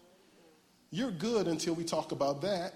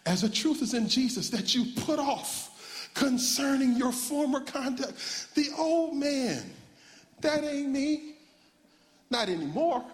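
high, drawn-out sermon cadence; preacher's scripture declamation; 8 semitones higher, slower than usual. Real, not acted. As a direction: proclaim the verse, stretching key phrases.

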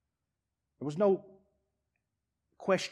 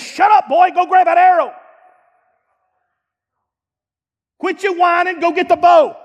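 loud, high commanding bark; stern father shout; 14 semitones higher, 12 dB louder than usual. Acted. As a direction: order the boy around. Prophet scolding a whining son.

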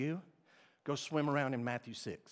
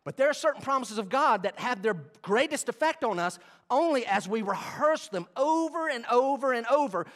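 ordinary talk

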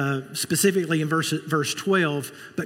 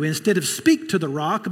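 ordinary talk, nothing marked

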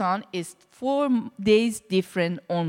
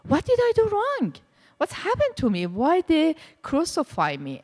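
anguished cry; 8 semitones higher than usual. Retold or imitated. Crucified in the dream, crying out.

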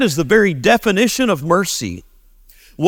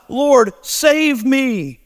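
slow, drawn-out plea, higher; slow plea, pitched up; crying out to God; 7 semitones higher, much slower than usual. Retold or imitated. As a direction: stretch the words out like a desperate prayer. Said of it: "he cries out to God".

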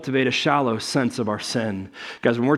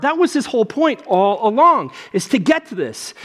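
usual speaking voice

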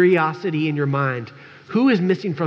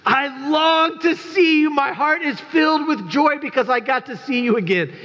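mock gushing; 11 semitones higher than usual. Pastor mocking what he'd never say to returning members, hammed-up gushing. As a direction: ham up the sappy greeting. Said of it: "you know what I probably don't say?".

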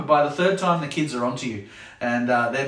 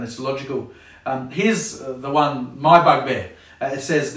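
ordinary talk

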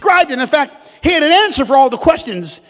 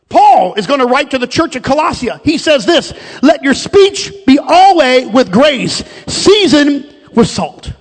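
loud, raised, deliberate scripture delivery; emphatic preaching; 4 semitones higher, 6 dB louder than usual. Real, not acted. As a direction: proclaim the verse to the congregation.

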